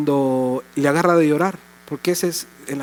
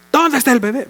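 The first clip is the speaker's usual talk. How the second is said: mock yell at a baby; 8 semitones higher than usual. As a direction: yell it like a startled parent. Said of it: "le gritan". (grito fuerte y agudo)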